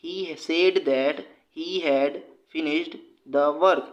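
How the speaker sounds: very slow, every word spaced out for students; slow dictation read. Shown as a demonstration. Teacher reading a quiz question to students, very slow and deliberate.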